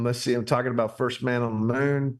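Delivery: ordinary delivery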